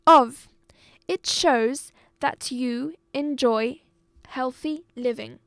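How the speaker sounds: deliberate dictation with pauses between phrases; loud, emphatic teacher's read-aloud; reading out clearly. Shown as a demonstration. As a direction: read aloud to a class, projected and carefully spaced. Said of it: "I'm reading out the words".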